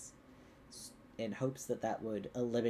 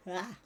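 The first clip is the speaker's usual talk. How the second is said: yawn; 7 semitones higher than usual. Real, not acted. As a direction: let out a big yawn. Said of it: "now I'm yawning".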